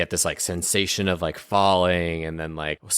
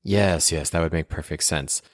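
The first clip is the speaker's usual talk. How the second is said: ordinary talk, nothing marked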